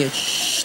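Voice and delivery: soft unvoiced shh of running water; water hiss sound. Comedian mimicking bathroom water as a soft hiss.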